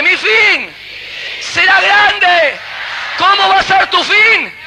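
high, loud shouted preaching; preaching at full force. Acted. Pastor rousing the congregation to repeat, shouting. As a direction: drive the crowd with every shout.

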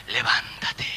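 whispered levántate, voiceless and very soft. Shown as a demonstration. Pastor gently calling the newly dead to rise, whispered. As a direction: breathe levántate as a hush. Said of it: "voz suavecita".

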